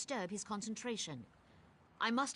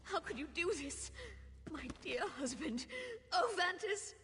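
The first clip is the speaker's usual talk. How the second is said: wailing grief; 10 semitones higher than usual. Acted. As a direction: beg the dead to return. Wife grieves over dead husband.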